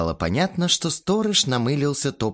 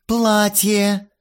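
higher, louder flirty chirp; coquettish chirp; 11 semitones higher, 5 dB louder than usual. A narrator's reading. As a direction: show off the dress playfully. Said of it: "кокетливо прощебетала Кристина".

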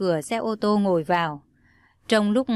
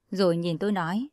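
usual speaking voice

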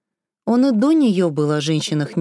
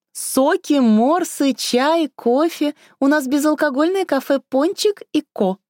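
bright waitress voice; 8 semitones higher than usual. A narrator's reading. Waitress lists drinks, high voice.

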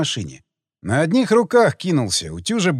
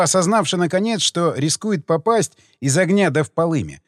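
ordinary delivery, nothing marked

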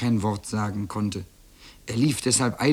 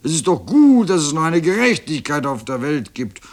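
loud, higher mooing speech; bellowing cow; 4 semitones higher, 7 dB louder than usual. A narrator's reading. Cow rejoicing that justice exists, bellowing. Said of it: "brüllte Mairos".

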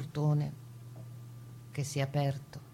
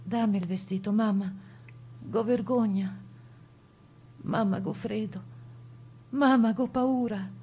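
a lightly voiced frightened little girl, pitched up, rising to a cry on the last mamma; child's voice; 5 semitones higher than usual. The returned deportee girl begging her mother, in a small child's voice. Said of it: "La Savaria con vose de creatura".

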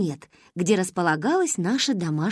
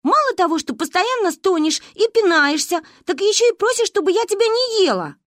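put-on higher, louder, cross girl's voice; annoyed Manka; 10 semitones higher, 4 dB louder than usual. A narrator's reading. Manka scolds Narine for kicking in her sleep, an annoyed, higher voice. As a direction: scold your sleepy friend. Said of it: "рассердился леший".

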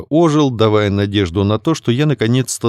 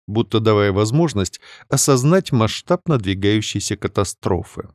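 usual speaking voice

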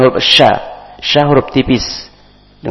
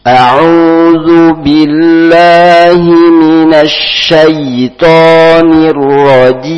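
slow, lifted tilawah chant; Quran recitation; 8 semitones higher, 8 dB louder, slower than usual. Shown as a demonstration. Teacher opening the Quran lesson, chanting the taawudh.

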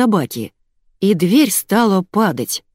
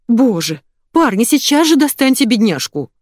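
agitated, higher-pitched urging; nervous; 6 semitones higher, 4 dB louder than usual. A narrator's reading. Diana ordering the men to rescue, nervous. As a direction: urge them anxiously, pitch climbing. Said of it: "занервничала Диана".

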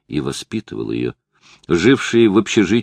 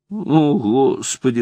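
whimpering; 6 semitones higher, slower than usual. A narrator's reading. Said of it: "захныкал Камерон".

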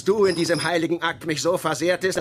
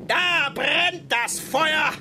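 shout; 4 dB louder, much slower than usual. Acted. Ahab exclaims at fire.

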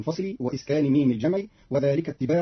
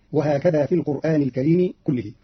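ordinary talk, nothing marked

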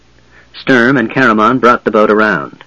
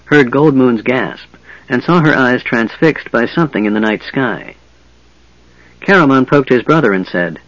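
ordinary delivery

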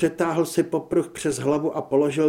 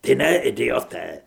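growl; 2 semitones lower, 3 dB louder than usual. Acted. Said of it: "zavrčel Nikolaj".

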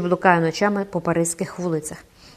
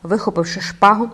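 usual speaking voice

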